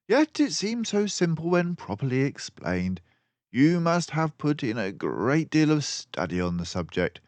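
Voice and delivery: earnest praise; admiring Dawson. A narrator's reading. Dawson complimenting Basil, lightly voiced.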